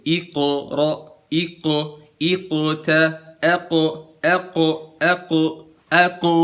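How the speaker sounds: "iqra" dengan qaf tebal dan pantulan jelas; qaf tebal memantul. Shown as a demonstration. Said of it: "gitu loh pantulannya, tebal". (qaf "iqra" dipantulkan tebal)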